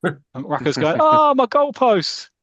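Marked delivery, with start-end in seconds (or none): 1.0-2.3 high, loud, drawn-out comic wail; dismayed cry. Acted. Boss watching his goalposts come down, dismayed. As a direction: cry out in mock horror, stretching it.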